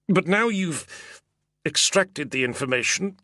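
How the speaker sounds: protesting. Acted. Senator protests, slow start.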